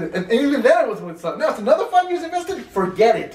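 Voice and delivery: high, loud, emphatic retold refusal; acted adamant refusal. Grave owner refusing to sell his plot, acted adamant refusal.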